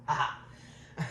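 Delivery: usual speaking voice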